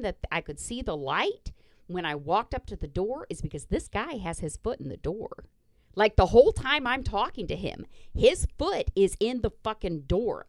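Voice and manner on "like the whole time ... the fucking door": raised pitch, loud and heated; worked up and emphatic; indignant venting. Real, not acted. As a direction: vent in outraged disbelief. Indignant venting about the man's foot.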